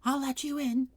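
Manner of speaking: high voice muffled behind a hatch. A narrator's reading. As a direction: speak from behind a closed door. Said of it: "said a muffled voice".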